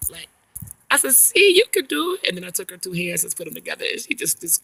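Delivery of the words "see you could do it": very high, loud, stretched coo; drawn-out high-pitched encouragement; praising baby talk. Retold or imitated. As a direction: praise a baby in sing-song.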